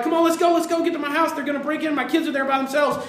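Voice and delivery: high, loud, panicked hurry-up; frantic urging. Retold or imitated. Caller chasing police to his house, frantic.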